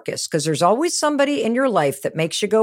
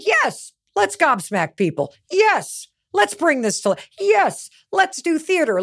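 higher, excited yeses; eager yes; 6 semitones higher than usual. Retold or imitated. Mel agreeing with the team, higher and eager. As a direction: say yes to everything, thrilled. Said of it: "when I said".

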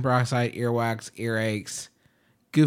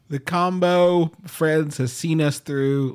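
higher, slow solemn tribute; hammed-up farewell speech; mock eulogy; 6 semitones higher, 4 dB louder, slower than usual.

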